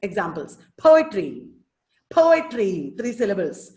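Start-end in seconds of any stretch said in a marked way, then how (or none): 0.8-2.9 POetry with a strong long po; first-syllable stress. Shown as a demonstration. Teacher shows the class a dactyl, stressing po in poetry. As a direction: punch po, let etry fall away. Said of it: "the first syllable is stressed".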